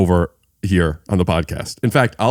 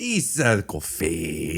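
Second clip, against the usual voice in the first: slow, softer Italian accent; 4 semitones lower, 8 dB quieter, much slower than usual. Shown as a demonstration. Guest proves he can do the accent, slow Italian accent. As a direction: drag it out in a put-on Italian accent. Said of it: "can't do an Italian accent".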